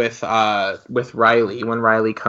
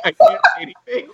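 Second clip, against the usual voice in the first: very high and fast, squeaking it out; a flustered squeal, tripping over the words; high-pitched, rushed and embarrassed; squeaky flustered; 3 dB louder, much faster than usual. Retold or imitated. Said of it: "I was like".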